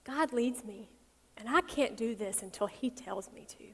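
soft, slow, tender firmness; gentle explanation. Acted. Jesus telling mom that God leads him, softly and tenderly. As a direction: let it down gently, softly and with pauses.

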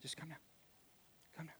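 very soft shushing, near whisper; acted hush with quiet, spaced-out pleas; hushing a crowd. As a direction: gently settle everyone down, barely voiced. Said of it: "gets everybody to calm down".

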